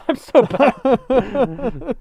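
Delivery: high, slow, laughing self-mockery. Real, not acted. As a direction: giggle through it. Laughing at herself.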